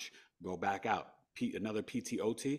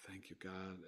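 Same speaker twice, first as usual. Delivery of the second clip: softer, whispered prayer; whispered thanks; 2 semitones lower, 14 dB quieter than usual. Real, not acted. Father hugging his son after seventy-two days, whispered prayer. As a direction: breathe the thanks to God.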